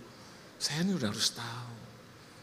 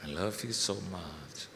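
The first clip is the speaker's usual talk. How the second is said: slow, low, loving murmur; tender declaration; 6 semitones lower, 4 dB quieter, slower than usual. Retold or imitated. Husband professing love to his wife, slow and tender.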